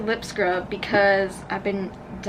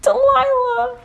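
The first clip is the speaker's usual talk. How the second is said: high, drawn-out call of the name; 5 dB louder, slower than usual. Pretend. Calling a farewell to her empty room.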